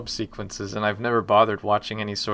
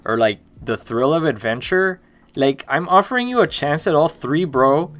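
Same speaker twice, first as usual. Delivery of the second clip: put-on louder Miami bro accent; 4 semitones higher, 5 dB louder than usual. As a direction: pitch a trailer deal in a Miami accent. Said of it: "You want me to do the trailer speech for Rosewater in the Miami accent?".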